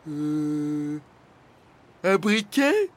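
hesitate, then venture a guess; hesitant suggestion. A narrator's reading. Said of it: "suggéra Flamèche". (suggestion hésitante, montante)

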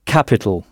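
"capital" struck on "ca", the ending reduced; first-syllable stress. Shown as a demonstration. Teacher repeating English capital for learners, stress on "ca". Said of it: "comme si vous frappiez sur cette première syllabe".

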